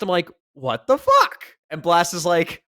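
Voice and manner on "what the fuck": exasperated disbelief. Retold or imitated. Retold complaint to Blast.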